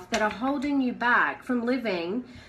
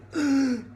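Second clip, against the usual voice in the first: panicked gasp; 5 semitones higher than usual. Acted. Acting a panicked money worrier.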